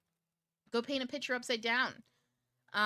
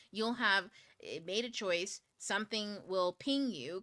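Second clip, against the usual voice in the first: usual speaking voice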